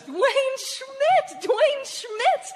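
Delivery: high, loud delighted cry of his name; excited recognition. Acted. Old classmate spots him, thrilled and loud. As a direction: shriek his name with glee.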